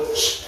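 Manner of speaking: a soft shh to quiet the class; teacher quieting pupils; hushing shh. Real, not acted. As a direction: hush the room. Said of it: "Tepuk diam".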